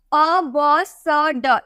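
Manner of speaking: अ, ब, स, ड said as short bare sounds one by one; letter sounds. Shown as a demonstration. Teacher drills students on the sounds अ, ब, स, ड. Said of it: "इस तरह से पढ़ा जाता है".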